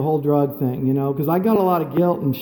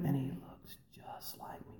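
hushed whisper of realization; whispering in disbelief; 6 semitones lower, 20 dB quieter than usual. Real, not acted. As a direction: breathe it out in shock.

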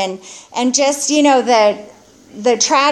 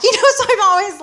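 a short real laugh breaking into the words, higher; 11 semitones higher, much faster than usual. Real, not acted. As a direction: laugh it off while explaining. Laughing about her hair loss.

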